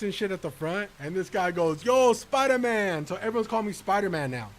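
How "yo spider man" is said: slow, drawn-out, higher and louder greeting; coworker greeting. Retold or imitated. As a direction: call it out lazily like a buddy at work. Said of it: "I say it like him".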